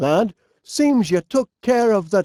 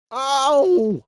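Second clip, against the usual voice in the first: sharp higher yelp of pain, as if stung; quick ow when something stings you; short startled cry of pain; 5 semitones higher than usual. Acted. Stung by a bee.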